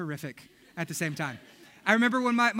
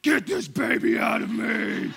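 desperate outburst; 4 dB louder than usual. Retold or imitated. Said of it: "you're like".

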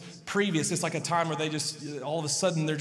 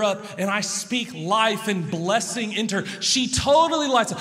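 emphatic preaching; 5 semitones higher, 6 dB louder than usual. Real, not acted.

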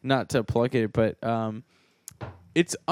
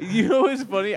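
ordinary delivery

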